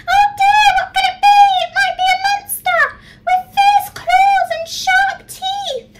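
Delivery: very high, fast, loud mouse squeaks; squeaking mice. A narrator's reading. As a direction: panicky little mice squeaking at once. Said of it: "the mice began to squeak all at once".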